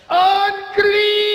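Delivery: high loud proclaiming cry; biblical shout. Pretend. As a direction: call it out like a prophet. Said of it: "just point 'em out like".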